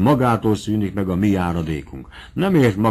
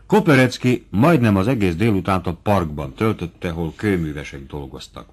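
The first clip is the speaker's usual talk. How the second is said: plain reading voice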